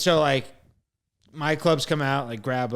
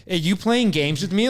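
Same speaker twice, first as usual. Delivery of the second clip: higher, louder retold outburst; indignant challenge; 5 semitones higher, 5 dB louder than usual. Frustrated traveler to baggage clerk over duffel bag, indignant. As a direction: push back at the clerk in mock outrage.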